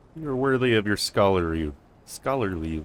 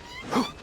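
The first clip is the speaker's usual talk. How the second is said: a sudden high gasp of surprise; sharp startled gasp; 5 dB quieter than usual. Real, not acted. Streamer startled mid-game, sharp gasp. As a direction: catch your breath in a jolt.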